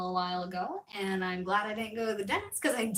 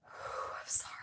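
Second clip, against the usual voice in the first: breathless whisper after laughing; whispering, laughed out; 12 dB quieter than usual. Real, not acted. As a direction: spent giggly whisper. Collapsing in embarrassed laughter.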